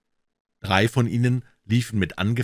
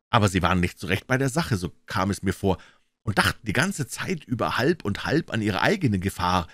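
ordinary delivery

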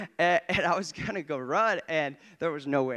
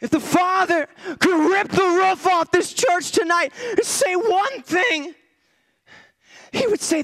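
high, shouted revival preaching; full-force preaching; 7 dB louder, slower than usual. Real, not acted. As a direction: drive it home like a fired-up preacher.

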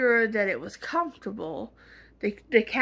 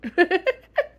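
laugh; 10 semitones higher, 6 dB louder than usual. Real, not acted. Laughs at own joke.

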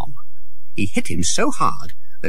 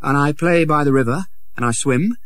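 lightly voiced happy boy Tom; 6 semitones higher than usual. Tom's voice.

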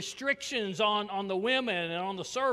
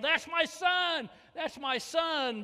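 high, slow proud father shouting from the stands; proud yell; 10 semitones higher, slower than usual. Retold or imitated. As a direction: call it out over the crowd, slowly. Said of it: "stood up in the stands and yelled".

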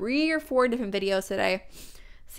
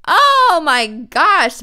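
voice going odd; 7 semitones higher, 10 dB louder, much slower than usual. Real, not acted. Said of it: "I don't know what happened to my voice".

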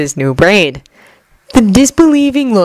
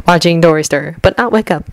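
ordinary delivery, nothing marked

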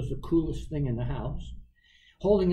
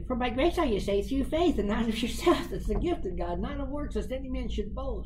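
higher quoted voice; heard voice; 7 semitones higher than usual. Retold or imitated. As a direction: chant the verse up high. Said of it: "they would say".

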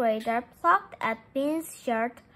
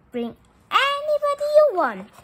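much higher and louder, bright invitation; forced cheerful call; 12 semitones higher, 8 dB louder, faster than usual. Acted. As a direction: push the cheer over the top. Bean inviting, forcing cheer.

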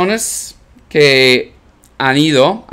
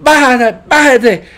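mock yell; 9 semitones higher, 4 dB louder, slower than usual. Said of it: "todos están gritando".

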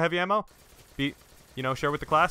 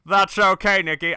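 loud, higher-pitched mock friend voice; Matt; 5 semitones higher, 8 dB louder than usual. Player pretending to be his silent friend, mock voice. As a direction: put on your friend's voice, pushed up and louder. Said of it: "I could pretend to be your voice".

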